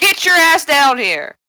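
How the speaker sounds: high, loud, slow order; bossy holler. Acted. Summoning Slyra to come down, bossy holler. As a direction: yell it up the stairs.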